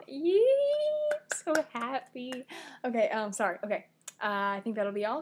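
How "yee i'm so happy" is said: high squeal easing into delight; happy squeal. Acted. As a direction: let out a gleeful little squeal, then gush. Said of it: "it's kinda like".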